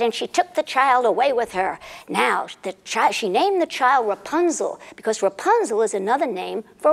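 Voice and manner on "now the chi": ordinary delivery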